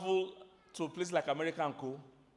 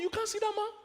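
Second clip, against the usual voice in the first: defensive driver. Retold or imitated. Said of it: "I'll be like".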